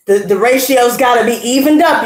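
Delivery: usual speaking voice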